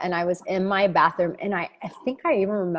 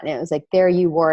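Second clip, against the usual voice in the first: ordinary talk, nothing marked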